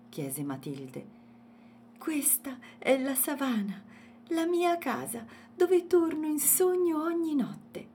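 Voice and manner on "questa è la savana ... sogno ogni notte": high, happy character voice; smiling little elephant. A narrator's reading. Elephant shows Matilde his savannah home, smiling. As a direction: present it with a smile in the voice. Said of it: "sorrise Agostino".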